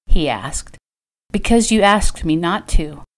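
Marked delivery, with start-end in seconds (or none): none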